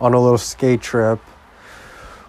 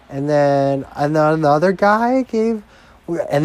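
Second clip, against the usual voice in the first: put-on a lightly raised, higher girl's voice telling her story; imitating a girl; 6 semitones higher than usual. Retelling what a drunk girl told him, mildly higher mock voice. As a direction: mimic her higher pitched and breezy. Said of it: "she was like".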